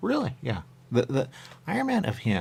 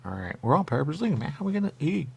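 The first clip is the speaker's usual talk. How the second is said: ordinary talk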